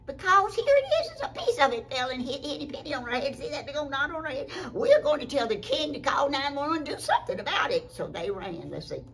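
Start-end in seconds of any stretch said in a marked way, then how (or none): 0.1-7.9 high, loud, fast explanation; hammed-up storybook urgency, rushing it out; frantic alarm. Henny Penny explains to the turkey, frantic.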